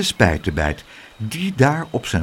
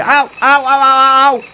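loud high yelps of pain; cries of pain; 11 semitones higher, 7 dB louder than usual. A narrator's reading. The weatherman yelping after the boy bites his thumb. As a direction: yelp as if bitten.

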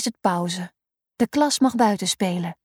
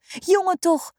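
high, startled teacher's gasp of concern; alarmed at the fallen boy; teacher rushing to a hurt pupil, startled and high; 8 semitones higher than usual. Acted. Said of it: "zegt ze geschrokken".

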